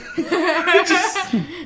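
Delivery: laugh. Real, not acted. Laughing at the bit.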